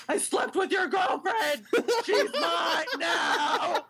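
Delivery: high, slow, stretched-out mock bellow; over-the-top drawn-out boast; hammed-up wrestling taunt. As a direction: gloat like a melodramatic wrestler. Acted wrestler-style taunt after touchdown.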